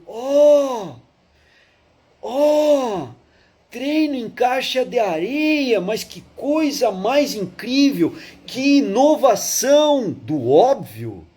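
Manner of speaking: high, drawn-out gushing wonder, sarcastic; mock amazement. Teacher mocking everyone wowed by sandbox training, exaggerated awe. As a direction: imitate a crowd marvelling, hammed-up and slow.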